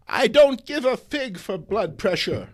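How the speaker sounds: put-on high, loud old-lady outburst, slowing to the punchline; defiant shout. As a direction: belt it out like a rebel granny. Aunt May defiant, shouting.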